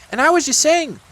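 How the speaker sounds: high, loud little-animal voice; Piglet butting in. Acted. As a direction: pipe up, cutting in high. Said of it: "put in Piglet".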